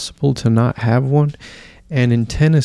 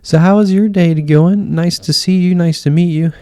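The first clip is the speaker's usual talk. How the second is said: higher, lilting pleasantries; fake-nice character mode; 6 semitones higher than usual. Imitating an interviewer greeting a guest, put-on niceness. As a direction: put on a chirpy hostess voice. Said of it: "goes into character mode or sociopath mode pretty quickly".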